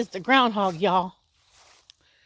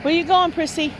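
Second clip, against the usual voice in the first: high coaxing call; 6 semitones higher than usual. Real, not acted. Calling to the cat.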